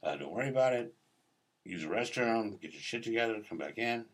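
soft, unbothered reassurance, retold; nice guy reassurance. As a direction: play a kindly man putting someone at ease. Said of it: "He's a very nice guy".

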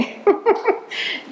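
rire bref sans voix, très aigu; rire soufflé. Real, not acted. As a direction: rire d'embarras, juste du souffle. Rit en demandant l'indulgence. (petit rire soufflé et gêné)

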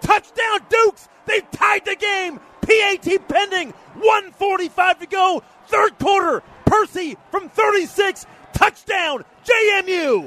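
excited. Real, not acted. Long touchdown run called.

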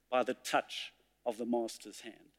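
a reverent, quiet close; hushed ending. Acted. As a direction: let the last line fall to a hush. Poem's closing line.

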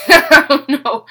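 loud, slow laugh through the words. Real, not acted. Admitting to viewers she is lost, loud slow laugh. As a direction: laugh it out in disbelief. Laughing at her own confusion.

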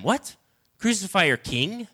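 high disbelieving question; can't believe what he hears; incredulous. Acted. Pilate asking the crowd, high and disbelieving. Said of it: "Pilate asked".

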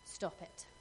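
whispered, breathy "Stop it."; hushed firm command. Retold or imitated. Friend hushing her crying friend, whispered firm stop. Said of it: "she looked me in the eye and she said".

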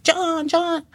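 very high, put-on child voice; excited kids calling. Kids calling to John at the train, high imitated child voices. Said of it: "And the kids were like".

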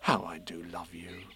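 low, soft declaration of love; tender Christopher Robin. A narrator's reading. Boy telling his bear he loves him, tender. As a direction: say it softly, full of affection. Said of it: "said Christopher Robin".

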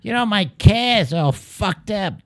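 mock Boston local, high and slow; Boston accent. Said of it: "I believe I sound a little bit like that".

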